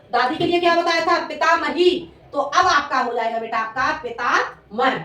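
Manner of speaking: ordinary talk, nothing marked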